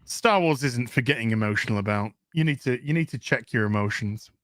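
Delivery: mock him as a lecturing know-it-all; mocking quote. Vlogger mocking a tweet in a low condescending voice. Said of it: "and says".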